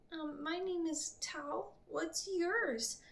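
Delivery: put-on high, eager little boy's voice; a curious child perking up; half excited child. A narrator's reading. Boy telling the priestess his name, high and half excited. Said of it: "he said half excitedly".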